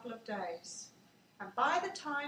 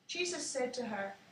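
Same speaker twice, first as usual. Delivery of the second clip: ordinary delivery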